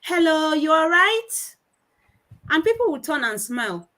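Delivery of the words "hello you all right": higher, eager little greeter; outgoing child greeting. Retold or imitated. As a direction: call out a friendly hello to strangers. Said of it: "first to greet strangers".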